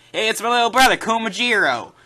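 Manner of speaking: loud, high, hammed-up southern character voice; exaggerated southern twang on a cheery introduction; mock southern accent. Dubbed character introducing his little brother, mock southern accent. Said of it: "that southern accent".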